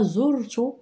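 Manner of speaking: very slow dictation with long pauses. Shown as a demonstration. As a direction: dictate it phrase by phrase, slowly. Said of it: "إذا لاحظوا معايا هنا".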